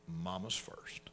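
hushed, breathy "Mama's first."; whispered repeat. Shown as a demonstration. Preacher whispers the rule to parents. Whispered after spoken line.

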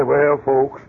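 a preacher sighing out his excuse; mock pious preacher. A preacher quitting his church, in a low put-on voice. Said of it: "they'll say".

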